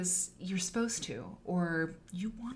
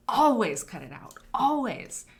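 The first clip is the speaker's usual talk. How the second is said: always much louder, pitched up; emphatic louder; 4 semitones higher, 8 dB louder than usual. Shown as a demonstration. Repeating the rule to students, loud emphatic. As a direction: belt out always as a command. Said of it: "If it is possible".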